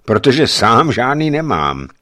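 the hulking guard answering with gruff pride; watchman voiced. A narrator's reading. Hromotluk answers the stranger, lightly voiced watchman. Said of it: "odpověděl Hromotluk".

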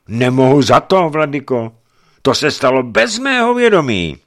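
high, loud, firm denial; firm reply. A narrator's reading. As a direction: insist firmly that you are blameless. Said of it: "odpověděl pevně Borecký".

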